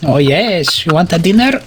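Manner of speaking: usual speaking voice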